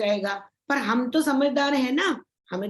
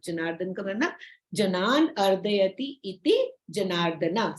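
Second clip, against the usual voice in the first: ordinary delivery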